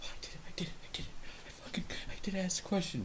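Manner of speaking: whispered, thrilled to himself; soft whispered self-congratulation after asking; triumphant whisper. Retold or imitated. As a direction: whisper the victory under your breath. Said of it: "that's him trying to assert himself".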